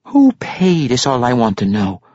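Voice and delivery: ordinary delivery, nothing marked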